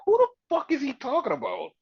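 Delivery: high, exaggerated puzzlement; bewildered. Host explaining the reaction to the co-host, high and bewildered. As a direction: replay total confusion in a high voice. Repeats own confused reaction.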